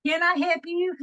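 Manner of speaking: high-pitched imitated Texas twang; Texan drive-thru worker. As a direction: play a chirpy Texan order-taker. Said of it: "I'd pull through the drive-thru".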